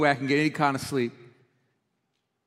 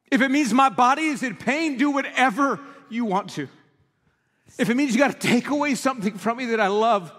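high, loud, slowed preaching; impassioned preaching; 6 semitones higher, 3 dB louder than usual. Acted. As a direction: drive the point home to the congregation. Preaching the lyric's meaning.

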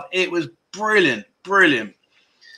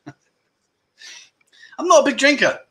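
laughing; 8 semitones higher, much slower than usual. Real, not acted. Repeats claim, laughing.

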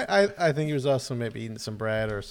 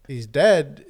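blunt retort; 9 dB louder than usual. Retold or imitated. The buddy insists, retold.

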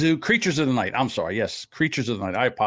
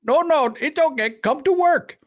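much higher, louder mock voice of the Taliban; a hammed-up Taliban coaxing people back to work; 12 semitones higher, 3 dB louder than usual. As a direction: ham up the Taliban's sweet-talk. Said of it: "the Taliban are like".

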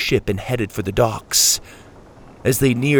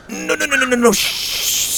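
very high, rapid-fire no's ending in a shush; insistent hushing; 13 semitones higher than usual. A narrator's reading. Sawyer trying to silence the squawking crow, insistent. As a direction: plead in a squeaky high voice for silence. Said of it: "Sawyer insisted".

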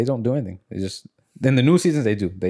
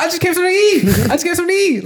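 much higher and louder squeaky excuse; mock caught man; 6 dB louder, faster than usual. Mocking the caught man's excuse to the host, high and loud. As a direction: whine the excuse like a caught creep.

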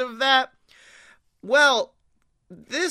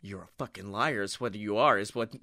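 much lower, quieter retort; low mock accusation; 11 semitones lower, 6 dB quieter, faster than usual. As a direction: drop the voice low to call him out. Retold retort to the boy.

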